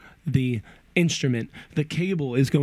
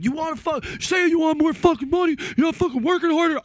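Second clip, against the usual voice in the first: high, fast mock rant of a yelling boss; a hammed-up angry boss yelling about more money; imitating angry boss; 12 semitones higher, faster than usual. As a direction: ham up the boss chewing him out.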